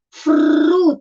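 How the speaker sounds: FRU drawn out, the F and R sounded together; blended consonants. Shown as a demonstration. Teacher reveals the extra mixed-in sound, drawn out. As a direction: stretch the FR cluster in FRU. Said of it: "tem outro somzinho misturado aí".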